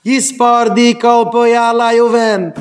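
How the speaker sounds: slow loud chanted verse, strained and high; shouted verse. Retold or imitated. Rebbe shouting a verse at the boy, slow and loud. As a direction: bellow the verse out slowly. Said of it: "ער האט געשרייען אויף אים א פסוק".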